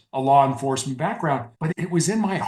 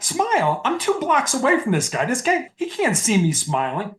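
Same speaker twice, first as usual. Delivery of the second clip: pitched much higher in mock disbelief, Smile?; incredulous disbelief; 7 semitones higher than usual. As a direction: scoff at the idea like it's absurd. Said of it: "And you're like".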